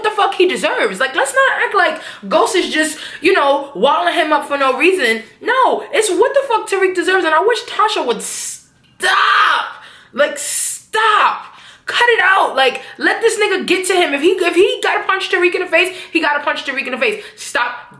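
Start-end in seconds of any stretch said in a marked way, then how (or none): 5.4-12.6 exasperated rant. Real, not acted. Vlogger ranting at Tasha.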